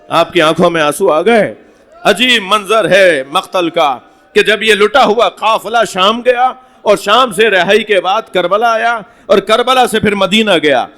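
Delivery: normal talking voice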